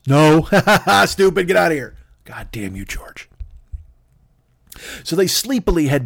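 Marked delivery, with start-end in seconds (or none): 0.1-2.0 loud blurted refusal breaking into mocking laughter; taunting laugh. George breaks promise, laughs. 2.2-3.3 low, very soft curse; acted weary resignation, much quieter; defeated grumble. Family gives up, grumbling.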